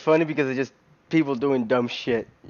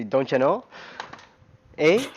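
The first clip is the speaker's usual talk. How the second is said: put-on light Minnesota accent on "Don't ya know? Eh?"; 4 semitones higher, 4 dB louder than usual. Mimicking Fargo characters for a friend, light Minnesota accent. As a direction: tease it in a Minnesota lilt. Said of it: "they have their accent".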